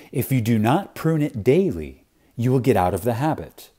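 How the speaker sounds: normal talking voice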